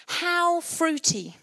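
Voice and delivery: very slow, stretched "fruity"; drawn out. Real, not acted. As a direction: stretch the word "fruity" right out. Pointed question to the church.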